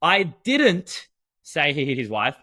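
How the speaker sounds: slow, emphatic "didn't", the rest lighter; stress on DIDN'T. Shown as a demonstration. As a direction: hit the word "didn't" hard, then pause. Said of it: "The word that you emphasize".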